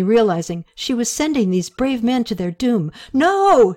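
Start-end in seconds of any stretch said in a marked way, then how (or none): 3.1-3.7 high, loud cry of alarm; alarmed scream at the men; yell. A narrator's reading. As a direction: shout to stop them in panic. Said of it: "she yelled".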